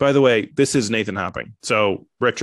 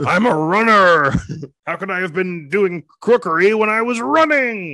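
hammed-up Nixon; 7 semitones higher than usual. Comedian as Nixon claiming he runs, hammed-up protest. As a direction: plead innocence as a comic Nixon.

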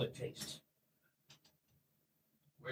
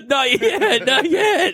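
laughing; 10 semitones higher, much slower than usual. Real, not acted. Laughing at a joke.

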